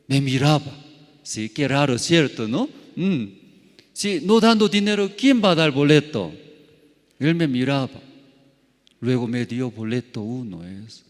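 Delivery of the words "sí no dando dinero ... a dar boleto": fast, higher and louder, arguing back; insistent retort. Retold or imitated. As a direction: rattle it off in protest. Retold argument with clerk.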